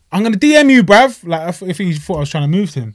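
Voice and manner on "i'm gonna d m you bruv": louder, higher call across the road. Retold or imitated. Calling to a boxer from the car, loud. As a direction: shout it out the car window. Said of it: "I was like".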